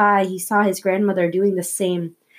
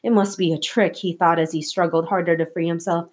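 ordinary delivery, nothing marked

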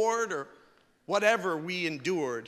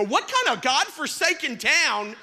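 loud, high laughing outburst; mock-shocked cry at the closed town; hammed-up indignant shout with a laugh; outraged disbelief; 9 semitones higher, 6 dB louder than usual. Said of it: "I go".

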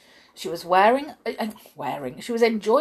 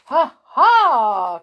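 loud, higher spoken "ha, ha"; gleeful cry; 6 semitones higher, 11 dB louder than usual. A narrator's reading. Mister Tickle spies his next victims, crying out gleefully. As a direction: crow it on spotting new victims. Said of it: "cried Mister Tickle".

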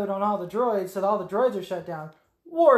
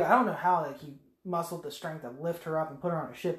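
usual speaking voice

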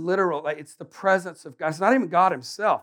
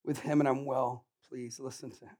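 quiet voice of God; solemn Father; 4 semitones lower, 10 dB quieter than usual. Acted. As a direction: lower and hush it with awe. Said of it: "the Father speaks from heaven and says".

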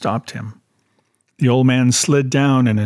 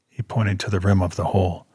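ordinary delivery

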